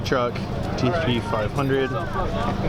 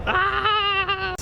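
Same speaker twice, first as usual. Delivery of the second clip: shocked gasp. Real, not acted. Thrilled at spotting a car.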